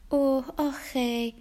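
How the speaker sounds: slow, high, drawn-out sympathy; mock pity. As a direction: coo over him with pretend sympathy. Rebecca mock-pities Jerry.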